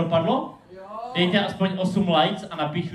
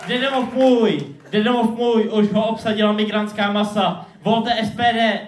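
higher, louder, declaimed like a rally slogan; mock political rant; 4 semitones higher, 4 dB louder than usual. Poet's lecture slips into party propaganda, mock political rant. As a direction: proclaim it with hammed-up patriotic alarm.

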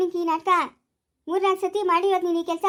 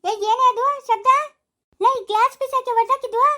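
put-on loud, higher scolding yell at the child; mother shouting about the smashed glass; angry shout; 7 semitones higher, 5 dB louder than usual. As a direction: yell at her over the broken glass. Glass broken, yelling at child.

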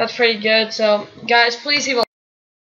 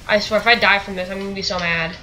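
ordinary delivery

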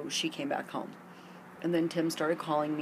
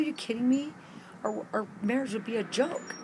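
incredulous retort; 7 semitones higher than usual. Retold or imitated. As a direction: fire back in shocked disbelief. Retorts to surprise proposal.

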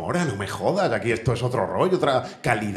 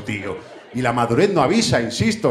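usual speaking voice

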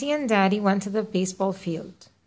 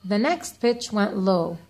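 plain reading voice

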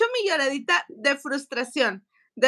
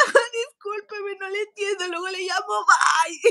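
tearful brush-off; 6 semitones higher than usual. Retold or imitated. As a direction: choke out the goodbye. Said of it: "Y yo así le dije". (al señor, llorosa y apenada)

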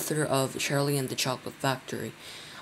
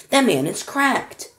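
much higher, louder crone voice; shrill old woman; 9 semitones higher, 5 dB louder, slower than usual. A narrator's reading. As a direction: squawk it like a cross old woman. Grandma complains.

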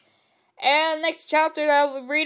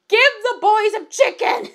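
exasperated call; 8 semitones higher than usual. Real, not acted. Reader's own outburst at story.

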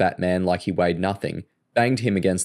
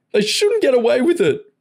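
tearful whine; 6 dB louder than usual. A narrator's reading. Joe whining after sobbing.

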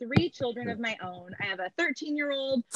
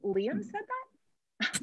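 slow, drawn-out and softer, rising in pitch with disbelief; mock outraged disbelief; 9 semitones higher, 6 dB quieter, much slower than usual. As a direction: let the outrage creep up slowly and quietly. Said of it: "I'm the type of mom who says".

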